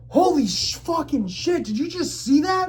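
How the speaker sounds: high, loud, incredulous outburst; stunned shock. Retold or imitated. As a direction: blurt it out in astonishment, pitch way up. Acts out his shock at falling bottle.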